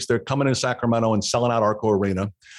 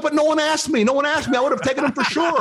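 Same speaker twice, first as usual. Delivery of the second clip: pitched-up defensive plea; high protest; 12 semitones higher than usual. Retold or imitated. To the chief, protesting high that nobody asked. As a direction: protest your innocence, voice jumping high.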